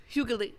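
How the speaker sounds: "Hugally" said as HYOO-guh-lee; attempted pronunciation. Shown as a demonstration. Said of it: "how did he say it?".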